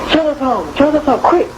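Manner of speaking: high, hurried alarm call; urgent warning. Retold or imitated. Children warning each other that father is home. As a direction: raise the alarm before he gets in.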